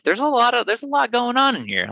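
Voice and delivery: amazed discovery. Retold or imitated. Retelling tasting complex sour beer, high amazed marvelling. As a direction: lift it way up, marvelling at the flavours.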